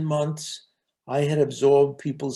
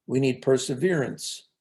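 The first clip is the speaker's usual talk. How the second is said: ordinary delivery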